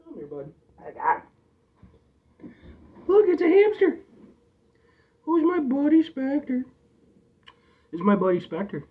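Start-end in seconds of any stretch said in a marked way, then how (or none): 3.1-6.6 high, loud cooing, then slow; excited pet talk. Real, not acted. Owner presenting hamster Spectre to viewers. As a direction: show off the pet with delight.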